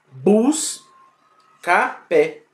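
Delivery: busca pé, stretched very slowly, syllable by syllable; syllables sounded out. Shown as a demonstration. Teacher sounding out busca pé very slowly for the class. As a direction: draw out busca pé slowly for analysis. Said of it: "Por exemplo".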